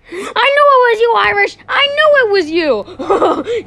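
high, loud villain gloat, laughing; villain reveal. Acted. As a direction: gloat as the unmasked killer, cackling.

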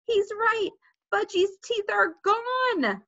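high wail, slowing to a drawn-out end; dismayed cry. A narrator's reading. Sheila confirms Fudge's teeth are gone, dismayed. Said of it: "Sheila screamed".

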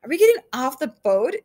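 high-pitched mild yell; yelled question. A narrator's reading. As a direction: call the question out over the storm. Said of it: "Jane yelled when Carter reached them".

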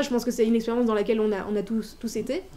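normal talking voice

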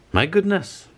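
put-on high startled exclamation in a child's voice; cried out. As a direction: squeak out the shock. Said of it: "he cried".

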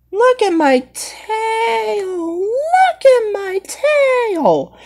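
high, slow, crying-ish plea; high slow whimpering character voice; whiny toy voice. Pretend. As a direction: wail it like a little creature wanting to be looked at. Toy character showing off its tail.

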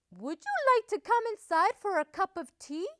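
high, lilting invitation; inviting mother duck. A narrator's reading. Missus Duck inviting the wolf in for tea, high and lilting. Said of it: "she asked".

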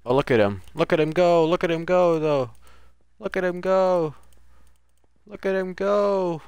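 usual speaking voice

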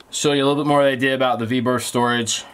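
ordinary talk

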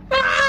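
high pretend squeal; high-pitched cry. Player in a pretend battle game, high cry. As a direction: let out a high "ah".

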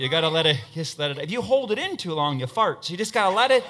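ordinary delivery

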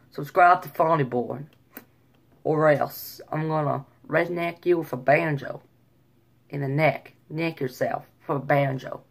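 put-on country Southern accent. Shown as a demonstration. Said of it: "All right, Southern".

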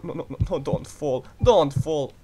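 ordinary talk

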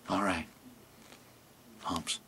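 very soft, low camel mutter; a sulky camel, low and hushed. Acted.

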